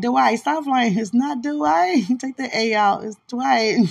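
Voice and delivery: loud, high mock scold; playful scolding. To Dwight, about his name, a loud mock scolding. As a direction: scold him laughingly. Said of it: "I'd be like".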